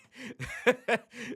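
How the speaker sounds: high, loud chuckle; amused laugh. Real, not acted. Host joking to [name] about clean versions, amused laugh. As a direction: laugh at your own plea.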